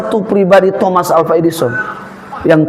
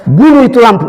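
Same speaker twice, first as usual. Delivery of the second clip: loud, higher and blunt, eastern Indonesian style; blunt eastern Indonesian order to kill the light; blunt eastern Indonesian command; 4 semitones higher, 4 dB louder than usual. Retold or imitated. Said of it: "Aduh, kasar sekali".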